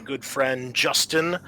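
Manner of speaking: normal talking voice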